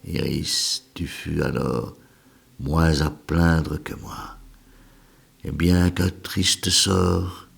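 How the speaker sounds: slow, measured elegy with pauses between lines; poem recitation. Acted. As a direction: recite a lament, swelling in grief then fading to a hush. (déclamation lente d'un poème élégiaque)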